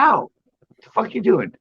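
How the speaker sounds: retelling a funny cat dub, the cat yelps in a high put-on voice; cat voiceover imitation. High-pitched yelp of a dubbed cat. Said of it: "He's like".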